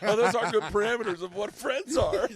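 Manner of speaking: high and loud, laughing sarcasm. Retold or imitated. Mocking Patrice's rules to co-host, loud laughing sarcasm. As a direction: ironic praise, cracking up. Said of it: "I was like".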